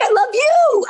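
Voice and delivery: hammed-up high-pitched outburst of love; excited gushing. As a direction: squeal the declaration back excitedly. Director gushes back, retold.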